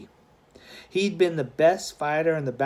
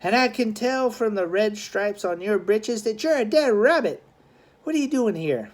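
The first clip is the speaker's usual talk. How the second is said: put-on defiant boy voice; 7 semitones higher than usual. Charlie confronts gang rival.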